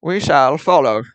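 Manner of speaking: put-on slow, lofty old British accent. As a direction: announce it like a period-film Englishman. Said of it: "old British".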